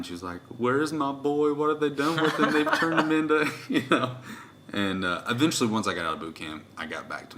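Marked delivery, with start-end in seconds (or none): 0.6-4.2 loud, high mock wail of a distraught mother; hammed-up tearful lament, pitched way up; imitating crying mom. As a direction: wail it like an upset mom, half laughing. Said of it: "she started crying".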